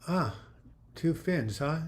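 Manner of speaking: ordinary delivery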